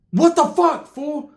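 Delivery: high-pitched indignant outburst; outraged. Retold or imitated. Confronting primo who left them.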